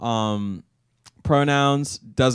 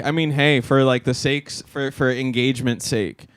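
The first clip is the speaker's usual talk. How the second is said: ordinary delivery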